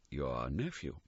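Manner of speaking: low, soft spectral voice; a grave quiet reminder; hushed ghost voice. Acted. The ghost naming Scrooge's nephew, softly.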